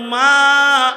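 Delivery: loud, high, long-held call; rising, sustained preacher's cry; calling to mother. Acted. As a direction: call out to an old mother, stretching the vowel. Judge calls out to old mother.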